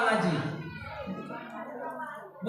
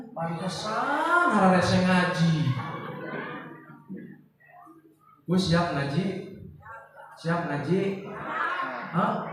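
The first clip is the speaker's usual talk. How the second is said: mock congregant; 8 semitones lower, 5 dB quieter than usual. Mocking a lazy congregant.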